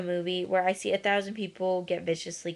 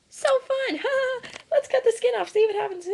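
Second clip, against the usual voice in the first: much higher, louder, giddy cruel voice with laughs; mock gleeful hunter; 14 semitones higher, 4 dB louder, faster than usual. Vlogger mocking seal hunters, gleeful cruel voice. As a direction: play a gleeful sadist cackling.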